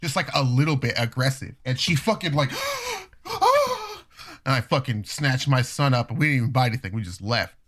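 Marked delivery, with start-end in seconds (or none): 2.3-6.1 high, slow shocked gasp; mock gasp. Lady gasping when her cart is pushed.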